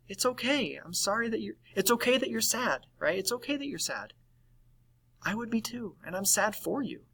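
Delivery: high, soft comforting voice; normalizing reassurance. Shown as a demonstration. Helping someone feel normal about losing their dog, tenderly reassuring. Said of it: "helping them to feel normal in their emotions".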